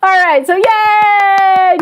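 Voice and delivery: slow, high, stretched cheer; drawn-out yay. Real, not acted. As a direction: savour the mock celebration. Ironic cheer ending story.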